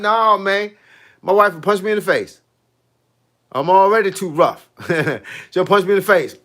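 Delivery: ordinary delivery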